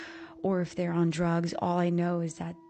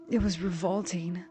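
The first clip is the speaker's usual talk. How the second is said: ordinary delivery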